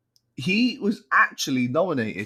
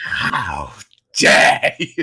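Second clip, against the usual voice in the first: loud, indignant unvoiced hiss; outraged dictator; 4 semitones lower, 9 dB louder, much slower than usual. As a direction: play the offended tyrant. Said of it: "I don't think you want to make an enemy of me".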